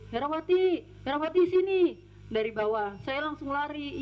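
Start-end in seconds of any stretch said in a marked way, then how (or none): 0.1-1.8 higher-pitched calling out; imitated summons. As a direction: call the maid over from downstairs. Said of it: "saya dipanggil sama Bu Erin".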